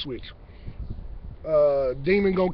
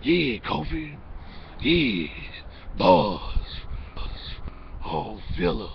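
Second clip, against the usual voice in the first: much lower, hammed-up cartoon villain; mock a rival's cheesy villain voice, dropping lower and lower; cartoon bad guy voice; 8 semitones lower, much slower than usual. Mocking a fellow rapper's cartoon villain voice, low and hammy. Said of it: "your bad guy voice from every cartoon".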